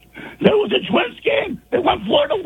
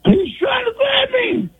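strained hoarse shouting; hoarse yell; 3 semitones higher than usual. Real, not acted. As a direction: yell through a ruined voice. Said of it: "Hurts my voice just listening".

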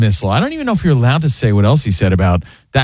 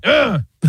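clearing throat; 10 semitones higher than usual. Real, not acted. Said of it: "Sorry, choking on a splinter".

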